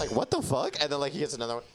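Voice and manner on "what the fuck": high-pitched retold astonishment; mock disbelief. Friend retelling a stranger watching lemonade chugged, mock disbelief. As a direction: imitate a baffled onlooker.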